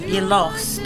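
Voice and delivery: normal talking voice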